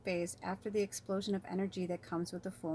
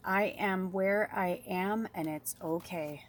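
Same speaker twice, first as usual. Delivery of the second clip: slow mantra read; slower than usual. Shown as a demonstration. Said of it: "your mantra is".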